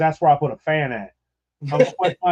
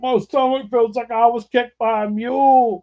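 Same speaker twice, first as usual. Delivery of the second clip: hungover uncle; 9 semitones higher, 3 dB louder than usual. Retold or imitated. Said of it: "he's like".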